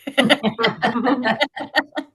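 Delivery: real laugh at a wordplay; amused laugh. Real, not acted. Host reacts to poet's wordplay.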